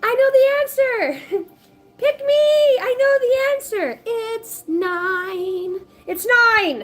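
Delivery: put-on very high, loud squeaky kid voice bursting to answer; eager know-it-all kid. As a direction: squeal it like a kid waving a hand in class. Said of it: "You're like".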